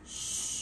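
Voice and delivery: soft shh for silence; shushing. Acted. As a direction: hush the little ones. Hushing the children.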